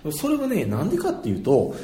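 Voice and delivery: ordinary talk, nothing marked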